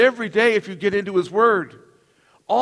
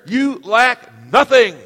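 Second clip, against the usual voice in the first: slow, loud, higher emphatic preaching; 6 semitones higher, 9 dB louder, slower than usual. Acted. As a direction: hammer the point home to the congregation. Preaching at full force.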